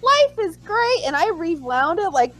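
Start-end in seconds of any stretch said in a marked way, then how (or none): 0.0-1.0 high-pitched fan elation; gleeful reaction. Retold or imitated. Fan retelling joy at the show improving, gleeful. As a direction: gush in a high voice, reliving relief.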